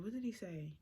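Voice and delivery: a quiet whispered aside to yourself; whispered to self. Real, not acted. Asking herself what he texted, whispered. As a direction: whisper it while trying to remember. Muttering to herself, trying to remember.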